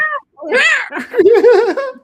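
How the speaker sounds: a sky-high, loud burst of laughter; high giggle. Real, not acted. Laughing at himself after denying he gets worked up. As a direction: cackle at his own fib. After his own denial.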